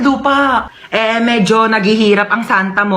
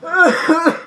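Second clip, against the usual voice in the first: weak faked cough; hammed-up sick cough; fake cough; 7 semitones higher than usual. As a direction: put on a sick-sounding cough. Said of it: "nilalagnat ako".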